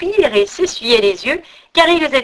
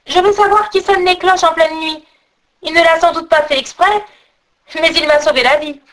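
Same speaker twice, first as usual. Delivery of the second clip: high, insistent exclamation; high, agitated call; urgent calling out; 6 semitones higher, 3 dB louder than usual. Acted. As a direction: demand it urgently in a raised pitch. Pierre calls out, arriving at church.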